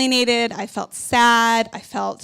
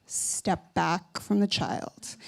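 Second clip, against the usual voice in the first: softer and slow, a firm inner warning; 4 semitones lower, 6 dB quieter, much slower than usual. Retold or imitated. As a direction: say it slowly and low like a voice in your head. Retold inner voice warning her.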